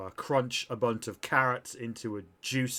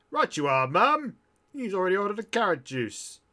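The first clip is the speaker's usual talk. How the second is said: high, loud, eager servant voice; robot bartender; 8 semitones higher, 5 dB louder than usual. Acted. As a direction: sing out the reply like a robot waiter. Robot bartender answers the order.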